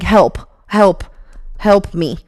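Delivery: ordinary talk, nothing marked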